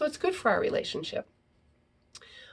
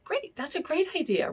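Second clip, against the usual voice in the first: cheerful agreement; 8 semitones higher than usual. Shown as a demonstration. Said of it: "cheerful reply to the direct ask".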